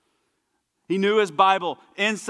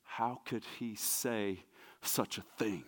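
slow, much softer rhetorical question; hushed wonder; 7 semitones lower, 14 dB quieter, slower than usual. Real, not acted. Preacher hushes the church, slow and soft. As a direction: draw them in, lean close and slow.

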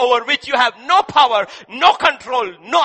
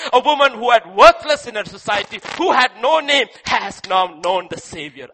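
louder, pressing sermon delivery; forceful preaching; 2 semitones lower, 4 dB louder than usual. Acted. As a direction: drive the point home loudly.

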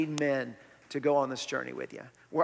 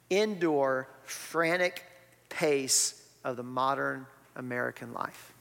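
slow emphatic reading; slower than usual. Shown as a demonstration. Pastor reads quote from book.

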